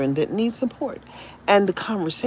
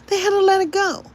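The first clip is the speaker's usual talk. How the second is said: "let it go" lifted much higher, sing-song; 11 semitones higher than usual. Shown as a demonstration. Gloating to listeners that the phone company gave in, high sing-song. Repeats line, two pitches.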